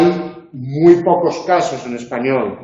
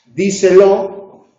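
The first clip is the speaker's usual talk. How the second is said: "Díselo" slow and louder, stressed on "Dí"; 4 semitones higher, 6 dB louder, much slower than usual. Shown as a demonstration. Teacher showing an esdrújula, first-syllable stress on "Díselo". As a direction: punch the first syllable of "Díselo", drawing the word out. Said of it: "es esdrújula y lleva acento en di".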